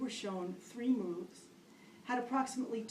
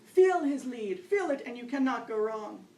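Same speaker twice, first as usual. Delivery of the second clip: loud, higher drill-sergeant commands; barking orders; 3 semitones higher, 10 dB louder than usual. Retold or imitated. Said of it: "Next barking order".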